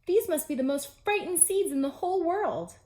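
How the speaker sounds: high, loud, anguished Toad voice; despairing Toad. Acted. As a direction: lament it loudly. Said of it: "cried Toad".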